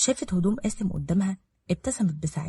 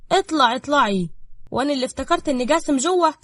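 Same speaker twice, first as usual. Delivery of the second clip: higher, louder sly teasing; mocking sly woman; 7 semitones higher, 4 dB louder than usual. A narrator's reading. Safaa taunts Ruqaya caught in the room. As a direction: catch her out with a sneer.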